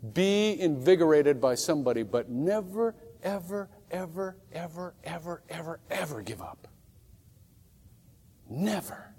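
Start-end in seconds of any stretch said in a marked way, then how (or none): 2.0-6.7 softer and softer until whispered; fading to a whisper. Acted. Preacher urging the church never to quit, fading whisper. Preacher builds repeated ever. 8.5-9.1 quiet never. Acted. Final word after whispered ever.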